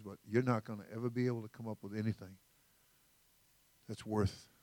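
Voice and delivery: ordinary talk, nothing marked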